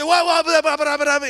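loud, high gibberish rant; mock chewing out. Lady chews out the attendant, mock rant. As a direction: mimic her scolding as noise. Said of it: "she started chewing me out".

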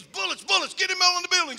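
high, loud, hurried orders; urgent yelling. Retold or imitated. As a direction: shout the family into cover. Urgent orders under gunfire.

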